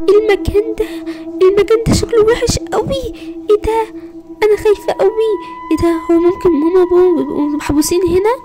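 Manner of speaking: high, tearful voice breaking with fear; frightened crying. Acted. Girl alone in the abandoned place, sobbing in fear. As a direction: cry it out, terrified and alone.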